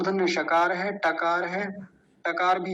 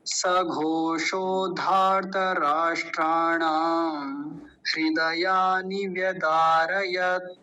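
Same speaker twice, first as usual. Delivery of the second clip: slow, flowing Sanskrit recital; flowing recitation; much slower than usual. Shown as a demonstration. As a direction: recite the whole verse slowly in one flow. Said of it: "एक बार प्रवाह से उच्चारण करता हूँ". (प्रवाह से धीमा पाठ)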